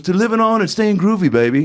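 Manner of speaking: high put-on star giving a toast; high-pitched impersonated toast. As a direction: toast in a high imitated musician's voice. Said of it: "he goes".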